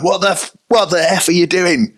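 mock furious yell at the players; furious outburst. Harry Redknapp, nearly hit by a ball, yelling at players, furious. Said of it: "Redknapp in his eyes is still furious".

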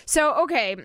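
ordinary talk, nothing marked

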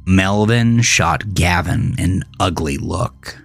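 plain reading voice

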